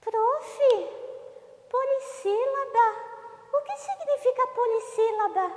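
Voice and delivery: very high-pitched put-on pupil voice; Fava. Pupil Fava asks the teacher a question, high put-on voice. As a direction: ask in a high little character voice. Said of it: "Sim, Fava, qual que é a sua pergunta?".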